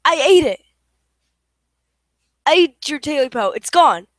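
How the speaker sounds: loud defiant yell. Acted. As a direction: shout it right at the creature. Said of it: "Smee yelled".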